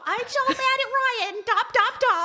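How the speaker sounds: high-pitched mock-angry squeal; squeaky, hammed-up tantrum; impression of mommy. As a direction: mimic a mad wife for the dogs. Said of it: "my impression of mommy".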